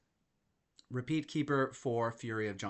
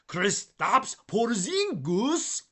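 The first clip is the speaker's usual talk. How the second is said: "Kristaps Porzingis" in a hammed-up Latvian accent, slow, much higher and louder; bad Latvian accent; 10 semitones higher, 9 dB louder, much slower than usual. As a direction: announce the name in a deliberately awful Latvian accent. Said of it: "That is the worst accent".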